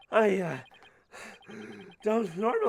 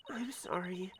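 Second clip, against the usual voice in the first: whisper; 9 dB quieter, slower than usual. Acted. Guilty confession, barely voiced.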